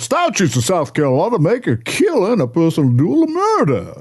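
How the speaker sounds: hammed-up Southern cartoon rooster; Foghorn Leghorn. Co-host reading old legal writing as a cartoon rooster, Southern bluster. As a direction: declaim it in a blustering Southern accent. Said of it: "any other voice but Foghorn Leghorn".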